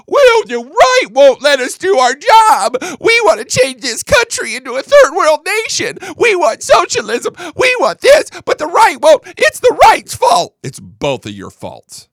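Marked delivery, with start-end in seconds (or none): 0.1-10.6 much higher, loud, slow mock shouting; mocking whiny liberal. Host mocking Democrats blaming the right, hammed-up whine. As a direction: ham up a shrill whining Democrat. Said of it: "they'll also be like".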